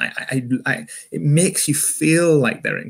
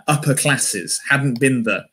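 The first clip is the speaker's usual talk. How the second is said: ordinary talk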